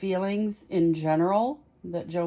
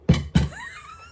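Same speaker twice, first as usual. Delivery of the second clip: loud burst of laughter; 5 dB louder than usual. Real, not acted. Loud laugh after series title.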